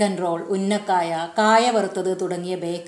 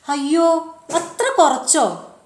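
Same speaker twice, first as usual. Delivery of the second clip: disbelief; 7 semitones higher, slower than usual. A narrator's reading. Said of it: "ജോസിന് വിശ്വാസമായില്ല".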